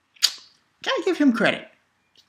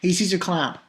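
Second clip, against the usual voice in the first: mocking taunt; 5 dB louder, slower than usual. Taunting the villain, laughing.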